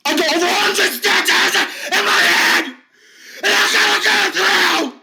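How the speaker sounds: high, strained ranting with bursts of shouting; distraught bullied man. Acted. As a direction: vent every hurt in one rush. Finn rants about the bullies.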